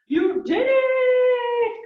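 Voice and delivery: high, loud, drawn-out cheer; excited cheer. Real, not acted. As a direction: celebrate their finish out loud. Coach cheers at workout end.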